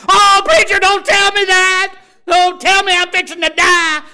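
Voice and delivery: hammed-up panic; panicked patient. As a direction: squeal it like a terrified patient. Patient panics at doctor's question.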